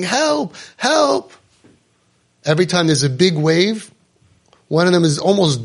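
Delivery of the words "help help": mock cry for help. Swimmer without a surfboard in the ocean, high mock cries for help. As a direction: play the drowning swimmer calling out. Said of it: "the other one is screaming".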